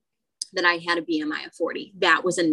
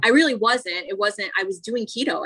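ordinary delivery

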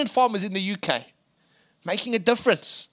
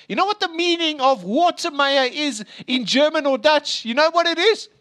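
excited teaser; 7 semitones higher, 4 dB louder than usual. Real, not acted. Preacher teasing the reveal.

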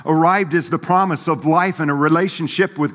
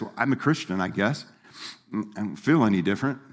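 softer, lightly voiced uncertain shrug; unsure mopey believer; 5 semitones lower, 6 dB quieter, faster than usual. Preacher imitates a doubtful churchgoer, softer and unsure. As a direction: mumble it like someone unconvinced of their faith. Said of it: "raised and grown up like this".

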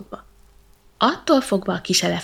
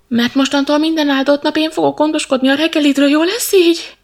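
pleading monkey; 7 semitones higher, 9 dB louder than usual. Acted. Monkey bargains for rescue.

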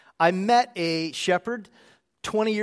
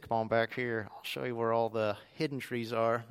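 low, quiet voice of a helpful worker; tree lot worker; 7 semitones lower, 6 dB quieter than usual. Retold or imitated. Worker inviting a customer to see the hidden trees, low and quiet. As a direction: play the worker, pitched well down and soft.

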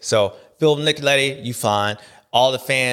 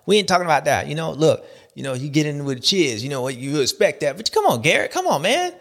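ordinary delivery

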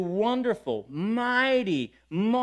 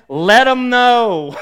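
slow, booming louder announcement; loud slow proclamation; 4 semitones higher, 13 dB louder, much slower than usual. Retold or imitated. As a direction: belt it out slowly like a sign from heaven. Reading God's sign on shirt.

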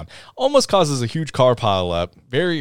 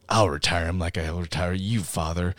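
menacing villain; 6 semitones lower, 7 dB quieter than usual. Acted. Villain threatens his father.

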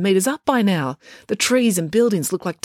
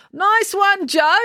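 high, bright-eyed cheer for Joe; enthusiastic; 13 semitones higher, 4 dB louder, slower than usual. A narrator's reading. April congratulating her brother hanging from the balloon, enthusiastic. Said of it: "said April enthusiastically".

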